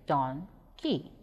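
"donkey" slowly split in two, pause between; syllable by syllable. Shown as a demonstration. Teacher showing pupils the syllables of "donkey", slow and separated. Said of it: "If we break donkey into syllables".

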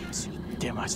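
hushed unvoiced whisper at the creature; whispering to the lurking monster in the dark. Real, not acted. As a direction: breathe it so the monster won't hear.

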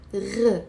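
"R" gargled in the throat, not a hard g; throaty gh. Shown as a demonstration. Teacher correcting the G spelling, throaty gargled "R". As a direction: isolate "R" like a French R. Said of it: "It's not a G".

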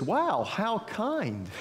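high, drawn-out storybook wonder; amazed pig. Acted. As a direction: marvel slowly as a delighted storybook pig. Voices the pig in children's story.

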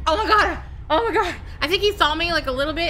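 loud panicked gasping; panicking gamer. Real, not acted. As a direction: blurt it out in alarm. Panics while hiding.

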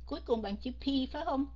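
usual speaking voice

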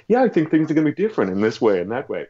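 light mock American accent. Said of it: "That was my American accent".

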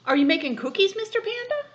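put-on high, bright child-like asking; eager little voice. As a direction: squeak it out like a curious little animal.

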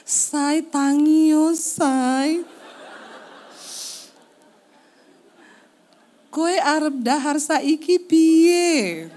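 slow, sweet exaggerated Javanese wife voice; put-on polite Javanese. Wife waking husband in mock-polite Javanese. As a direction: drawl it out like a dainty Javanese wife. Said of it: "aku koyo wong Jawa".

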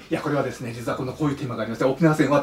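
quiet, fast pitch to a reporter; rushed explanation. Retold or imitated. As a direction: gabble through it quietly.

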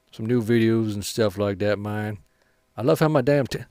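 ordinary talk, nothing marked

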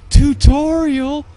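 high, loud disbelief; squawking, astonished outburst; incredulous. Real, not acted. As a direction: exclaim it in outraged shock. Finds it was only tutorial.

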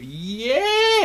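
high-pitched sustained "yay"; mock crowd cheer, way up high; wave cheer. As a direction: squeal out a pretend stadium cheer. Acting out a stadium wave.

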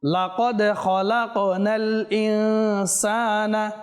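slow, drawn-out melodic tajweed chant; Quran recitation. Shown as a demonstration. Teacher reciting a verse for viewers to follow, slow melodic chant. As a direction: chant each syllable long and measured.

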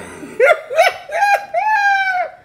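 real burst of helpless laughter; loud high cackle. Real, not acted.

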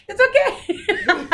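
slow high quote choked with laughter; laughing imitation. Telling the story of partner breaking the sink, laughing mimicry. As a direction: mimic her sheepish reassurance through giggles.

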